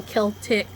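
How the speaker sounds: "Celtic" said with a hard K; hard K pronunciation. Shown as a demonstration. Vlogger tries the pronunciation she doubts, hard-K "Celtic". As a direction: start "Celtic" with a K sound, as in kick. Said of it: "I don't think it sounds right going".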